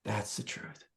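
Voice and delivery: whispered, fading out. Retold or imitated. Telling the hippies the truth, in a whisper. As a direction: breathe it like a secret. Retelling his speech to the hippies.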